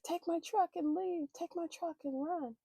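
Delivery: high retold plea to flee; imitating urgent boyfriend. Voices boyfriend urging escape.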